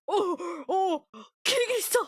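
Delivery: crying out Kirigiri's name in a high put-on whine; whiny imitation of the anime boy; mimicking him. Mocking how he always calls Kirigiri. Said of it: "The way he talks".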